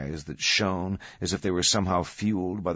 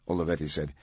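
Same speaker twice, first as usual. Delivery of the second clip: ordinary delivery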